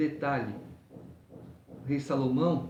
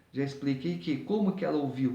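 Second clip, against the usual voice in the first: ordinary delivery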